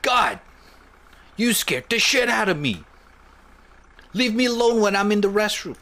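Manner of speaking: high, loud, fast yelling; furious startled yell; angry shout. Acted. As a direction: scream at the intruder in the restroom. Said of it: "I was supposed to be the one who screamed".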